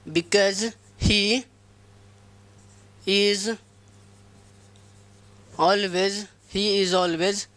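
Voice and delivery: very slow, word by word dictation. Shown as a demonstration. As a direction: dictate each word slowly to the class. Dictating sentence to class. (धीरे-धीरे बोलकर लिखवाना)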